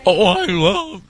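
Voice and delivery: high put-on voice mocking an awkward listener; a hammed-up voice of a shy donor. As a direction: squeak it out like a self-conscious caller. Mocks a listener's awkward remark.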